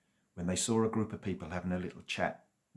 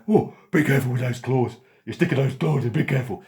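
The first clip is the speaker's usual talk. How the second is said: loud, higher yelping complaint; pained loud dog; 6 semitones higher, 13 dB louder than usual. Acted. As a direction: cry out as claws dig in. Dog yelps at claws.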